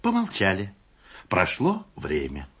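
ordinary talk

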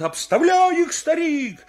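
high, loud race-side yelling; excited spectator shouts; crowd shouting. Acted. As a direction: urge the runner on at full voice. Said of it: "мне всё кричат".

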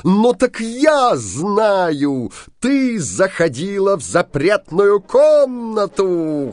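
high, loud, slow accusing yell; furious shout. Acted. Bluebeard accusing his wife of entering the room, high furious yell. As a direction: hurl the accusation at her full voice. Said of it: "закричал Синяя Борода".

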